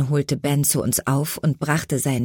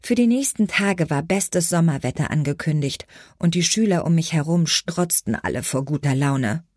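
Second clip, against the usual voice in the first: ordinary delivery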